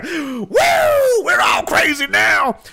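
shrill crazed cheer, slowed; manic whoop. Pretend. A crazed soldier whooping to his audience, shouted. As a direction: whoop like a soldier gone mad.

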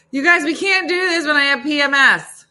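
loud, high, emotional plea; tearful protest. Real, not acted. Protesting to viewers about PMS, tearful wail. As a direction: wail it out, half in tears. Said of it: "I'm crying over everything".

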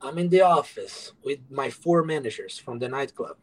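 ordinary delivery

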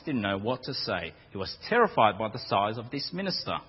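normal talking voice

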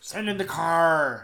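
slow, loud villain command; movie villain quote. Retold or imitated. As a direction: bark the order slowly like a terrorist. Quoting the Die Hard villain.

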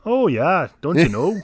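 hammed-up Canadian accent, loud and high; big Canadian accent. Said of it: "You have a good Canadian accent?".

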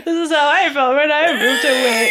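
high, loud laughing mock sob; laughing cry. Mock sobbing over lost friends.

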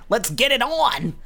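high, slow hammed-up Joey; playful Joey impression. Quoting Joey to friends, high hammed-up voice. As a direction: belt it like a cocky brawler. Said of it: "Joey going".

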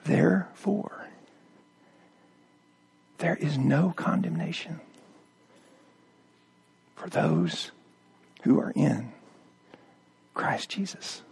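soft, low, near whisper with long pauses; hushed scripture reading. Acted. Preacher reading Romans softly to the church: hushed. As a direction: read it like a prayer in the dark.

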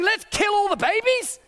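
high mocking voice of an opponent. Pastor caricaturing his opponents, high mocking voice.

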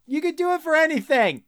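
high-pitched comic indignation; exasperated high protest. Real, not acted. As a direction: throw it out high, incredulous and fed up. Ranting about a lazy card.

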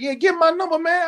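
retelling asking for a friend's number, excited; eager retold line. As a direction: pitch way up, keen to reconnect. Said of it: "I said".

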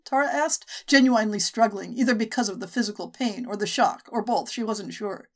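plain reading voice

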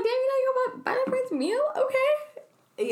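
very high-pitched mock indignation; mock reproach. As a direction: squeak out a put-on affronted reaction. Retells her sarcastic reaction.

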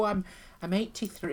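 a hint of a proud old dear; lightly voiced old lady. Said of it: "they go".

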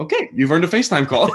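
laughing. Retold or imitated. Retold reply, laughing.

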